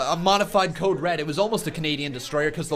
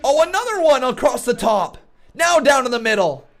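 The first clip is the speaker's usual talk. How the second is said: high, loud, stretched-out shouts on the big spots; milk the finish, slow and screaming; live play-by-play; 12 semitones higher, 8 dB louder, slower than usual. Real, not acted.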